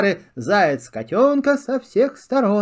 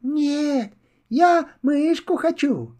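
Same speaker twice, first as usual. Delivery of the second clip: small kitten voice; 8 semitones higher, slower than usual. Acted. Kitten answers squirrel.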